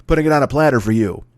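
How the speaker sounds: ordinary talk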